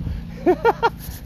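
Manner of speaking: a quick laugh carried on breath alone; high laugh with no voice, amused; breathy laugh. Real, not acted. Laughing at the feud.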